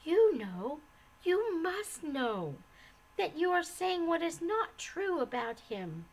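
high, urgent persuasion; heartfelt, insistent reassurance; earnest. A narrator's reading. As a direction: plead sincerely, with emphatic swoops of pitch. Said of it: "cried Mercy earnestly".

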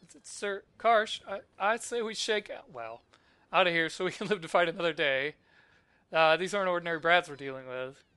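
lightly acted game henchman, urging a retreat; lightly voiced villain lackey. Henchman telling Sir Karsh to shake out, lightly voiced retreat. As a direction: read it as a rattled henchman, lightly hammed up.

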